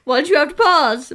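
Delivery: loud laughing protest. Real, not acted. Laughing outburst at partner.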